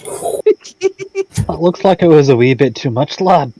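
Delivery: a wee dwarf lilt, drawn out; Scottish dwarf voice. Acted. Dwarf player in character voice.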